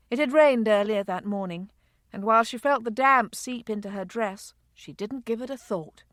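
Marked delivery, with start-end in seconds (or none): none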